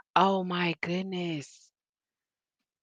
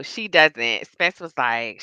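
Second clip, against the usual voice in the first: ordinary delivery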